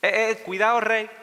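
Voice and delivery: mock-wary heads-up from the accusers; warning accusers. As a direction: caution the king, pitch lifted. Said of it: "rodearon al rey y le dijeron".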